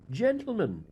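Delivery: a loud, high call of greeting; bold call. Acted. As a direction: announce yourself to the police, full of bravado. Addressing police with bravado.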